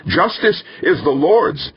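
high-pitched preacher's bellow. A narrator's reading. Preacher rebuking an angry mob, bellowing. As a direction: thunder it out at the crowd. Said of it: "Danvers bellowed".

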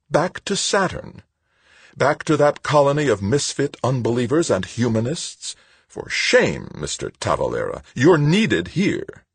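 high-pitched, hardened reproach. A narrator's reading. Woman shaming Tavalera for wanting Saturn, hardened. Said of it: "but her voice hardened".

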